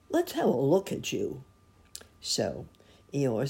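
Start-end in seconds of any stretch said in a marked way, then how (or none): 0.1-1.4 lightly voiced helpful bear, higher; a hint of eager bear. A narrator's reading. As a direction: offer help in a higher voice. Pooh offers help, against Eeyore's drone.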